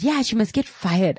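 high-pitched mock client demand. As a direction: imitate an irate client insisting. Retold angry client demanding firing.

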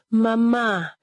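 high, drawn-out mild cry; cried out. A narrator's reading. Grieving daughter on her knees, crying out. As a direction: cry out to mother in grief. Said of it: "she cried".

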